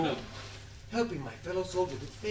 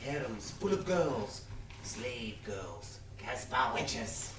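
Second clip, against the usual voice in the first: slow, low and soft; dreamy savouring; 8 semitones lower, 6 dB quieter, much slower than usual. Acted. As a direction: drift into a hushed fantasy, lingering on each phrase. Savouring a fantasy for mate.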